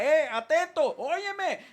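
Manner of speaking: high, loud hail; urgent mock call to someone not listening; calling for attention. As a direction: jolt a distracted listener awake. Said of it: "que Dios me sacuda".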